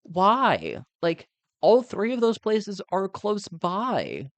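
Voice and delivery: high, slow, incredulous complaint; exasperated disbelief. Retold or imitated. Outvoted sibling questioning dad's swap, high exasperated disbelief. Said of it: "it's just like".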